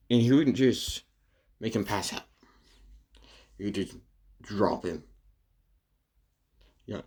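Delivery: usual speaking voice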